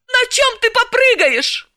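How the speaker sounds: Galina, mock-grieving. Galina mournfully asking Dalila how she'll get to work. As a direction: ask it with mock sorrow. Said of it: "горестно вопросила".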